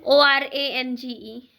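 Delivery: very slow, letter-by-letter spelling. Real, not acted. Mother spelling orange for her child, slow letter-by-letter dictation. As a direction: spell it out with space between letters.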